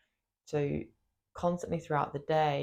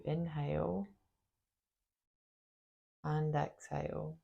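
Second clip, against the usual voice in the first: guided meditation. Acted. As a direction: guide them gently into the body, leaving space after each phrase. Leads a body meditation.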